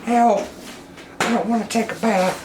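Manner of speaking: high, pleading little monkey voice begging for help; voicing the monkey. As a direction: squeak out a desperate plea not to be bathed. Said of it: "He's like".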